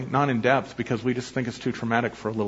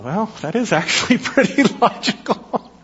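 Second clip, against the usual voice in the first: higher, louder, breaking into a real laugh; 9 semitones higher, 5 dB louder than usual. Real, not acted. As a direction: crack up while getting the words out. Preacher cracks up at child's logic.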